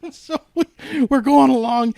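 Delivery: giggling as the story goes on; laughing. Real, not acted. Laughing to friends about the storm drive.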